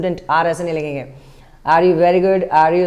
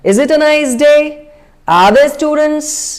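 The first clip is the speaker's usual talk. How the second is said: higher, slow, whole question run together rising; sentence as one word; 5 semitones higher, 3 dB louder, much slower than usual. Shown as a demonstration. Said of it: "ऐसा बोलो जैसे आप पूरा एक sentence नहीं, word बोल रहे हैं". (पूरे वाक्य को एक शब्द की तरह, ऊपर जाती टोन)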